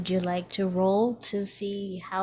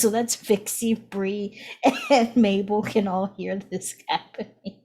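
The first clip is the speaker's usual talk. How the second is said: ordinary talk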